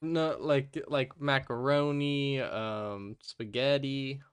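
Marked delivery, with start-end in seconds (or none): none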